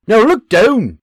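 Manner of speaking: loud, high Scottish order; Scottish bark. Acted. Scottish sergeant barking an order at the private. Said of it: "His sergeant barks".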